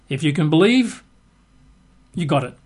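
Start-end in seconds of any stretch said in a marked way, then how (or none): none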